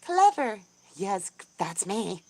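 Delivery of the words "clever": high, loud burst of surprise; surprised exclamation. Real, not acted. Streamer reading out a viewer's compliment, surprised. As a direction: exclaim it in pleased disbelief.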